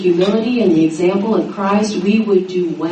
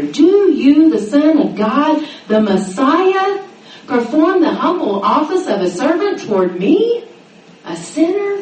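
the Son of God, the Messiah, stressed and slowed; emphatic question; 6 semitones higher than usual. Retold or imitated. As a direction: press every word in disbelief. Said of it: "the Greek is very emphatic here".

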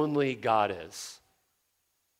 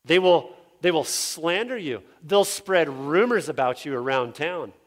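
normal talking voice

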